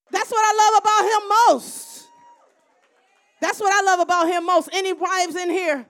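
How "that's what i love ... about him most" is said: high, loud proclamation; loud emphatic. Real, not acted. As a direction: proclaim it to the room. Repeats line, soft then loud.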